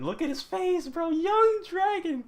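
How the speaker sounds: high, loud, drawn-out marvelling; amazed gushing. Real, not acted. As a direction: stretch it out in high-pitched awe. Gushing over young Dragon's face.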